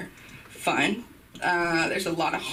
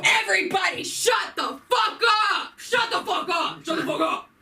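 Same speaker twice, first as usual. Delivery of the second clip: retold frat yell; 10 semitones higher than usual. Frat guy silencing the party, mock shouting. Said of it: "They go".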